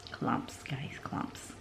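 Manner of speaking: hushed whisper of clumps. Real, not acted. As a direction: breathe the word clumps out in a whisper. Whispering the scary word to viewers.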